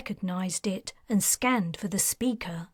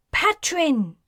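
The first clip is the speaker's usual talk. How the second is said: higher, loud call; 7 semitones higher, 5 dB louder than usual. Acted. Talon calling her son, loud. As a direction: pull him back by name.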